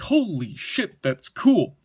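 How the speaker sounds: drawn-out, emphatic mock awe; punchy retold wow reaction; retold amazed reaction. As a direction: imitate being floored, stretching the words. Retold reaction to knife drop.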